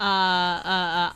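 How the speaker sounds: ordinary talk, nothing marked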